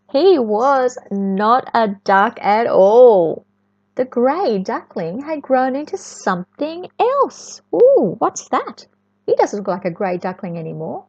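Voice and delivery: usual speaking voice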